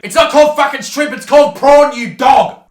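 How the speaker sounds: loud, high outraged correction; angry shout. Acted. Host correcting rival on shrimp versus prawn, angry shout. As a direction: yell it in comic outrage.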